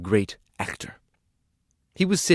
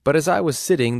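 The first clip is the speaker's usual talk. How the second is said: usual speaking voice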